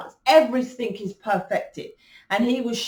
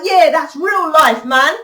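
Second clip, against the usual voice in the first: scoffing much louder at the Hollywood act; mocking retort; 5 dB louder than usual. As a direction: call out the fake perfect image loudly. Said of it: "I'm like".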